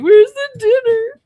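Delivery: high, loud, slow mock wail; put-on whiny dog plea, drawn out loud; guilt-tripping dogs. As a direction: wail for food like a pleading dog, hammed-up. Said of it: "I'm getting guilt tripped. They're both looking at me like".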